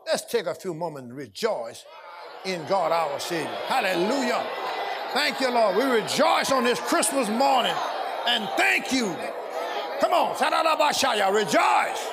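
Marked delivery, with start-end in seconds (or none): 3.7-12.1 rejoicing praise. Real, not acted. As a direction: lift the room into praise. Leading the church in rejoicing.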